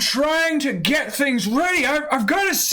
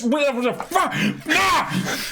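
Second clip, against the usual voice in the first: tongue-tied yell of frustration; blurting out in defeat; frustrated outburst. Real, not acted. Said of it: "It's not my fault".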